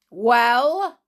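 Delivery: loud, high, challenging shout; menacing demand. Acted. Armed man demands answer from hider.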